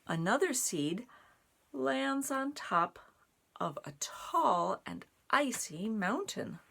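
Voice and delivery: bright storybook narration; reading to children. A narrator's reading. Narrating the mountain page to children, bright storytime voice. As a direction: set the snowy mountain scene. Reads picture book aloud.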